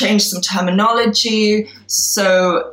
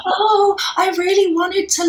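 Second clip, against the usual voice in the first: meek younger self; 11 semitones higher than usual. Retold or imitated. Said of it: "And I was like".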